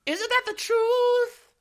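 put-on high girly voice. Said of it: "She sighs and nods".

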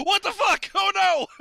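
loud, high comic panic; panicked stab victim. Acted. Comedian playing a stab victim's sudden realization, panicked. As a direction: yelp in mock horror.

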